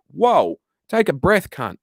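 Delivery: high, drawn-out "whoa" of disbelief; slow down a ranter with exaggerated dismay; mock calming exasperation. Streamer answering an imagined ranter, mock exasperated calming.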